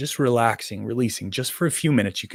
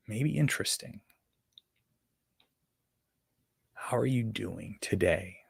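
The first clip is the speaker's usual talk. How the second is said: slow, soft meditation voice; guided meditation; 3 dB quieter than usual. Acted. Meditation teacher prompting an inner conversation, soft guided meditation. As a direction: ask softly and leave long pauses.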